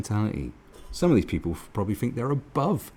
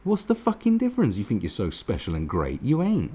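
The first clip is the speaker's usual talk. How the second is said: normal talking voice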